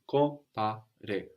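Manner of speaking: "compare" said very slowly in three syllables. Shown as a demonstration. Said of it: "Those are three syllables".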